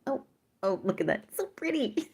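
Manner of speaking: high squeaky gush; high delighted coo. Real, not acted. Gushing over something.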